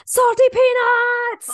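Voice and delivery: sudden high loud yell of Salty peanuts; shout. Shown as a demonstration. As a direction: blurt Salty peanuts at full volume, slowly. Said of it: "you have to say this".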